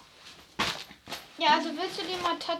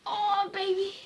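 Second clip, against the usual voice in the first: ordinary delivery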